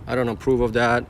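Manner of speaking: ordinary talk, nothing marked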